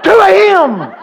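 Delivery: high, loud yell of disbelief; shocked shout. Retold or imitated. Preacher hearing the gig starts at two, shocked shout. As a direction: explode at the late hour.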